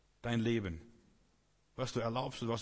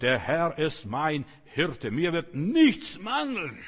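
slow, loud, higher proclamation; solemn psalm recitation; 4 semitones higher, 9 dB louder, much slower than usual. Shown as a demonstration. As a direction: declaim the psalm slowly with force. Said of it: "die Psalmen zitiert". (langsam, laut und feierlich ausgerufen)

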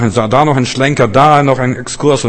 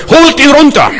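a much higher, louder mob yell; angry shout; 8 dB louder than usual. Retold or imitated. Brothers shouting down the young prophet, much higher and louder. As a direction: bellow it like an outraged crowd. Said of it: "Dann rufen die Brüder".

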